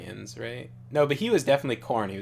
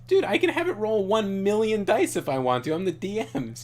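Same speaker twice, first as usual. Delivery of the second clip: mock rant; 10 semitones higher, 7 dB louder than usual. Real, not acted. Ranting about annoying player.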